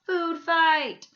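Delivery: high, loud yell. A narrator's reading. A kid in the lunchroom yelling the start of the fight. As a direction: shout it across the lunchroom. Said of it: "Somebody yelled".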